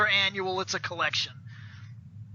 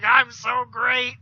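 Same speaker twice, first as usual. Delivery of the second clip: slow, lightly put-on monster gloat; gloating Goldar; slower than usual. Goldar plays with his figurine, slow mock gloating. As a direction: stretch it out, imitating a pleased monster.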